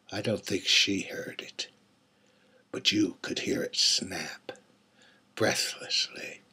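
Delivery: breathless hushed whisper. A narrator's reading. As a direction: lean in and whisper the killing. Killer recounts the neck snap.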